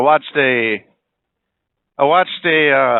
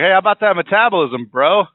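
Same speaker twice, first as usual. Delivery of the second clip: mocking taunt; 6 semitones higher than usual. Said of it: "You're like".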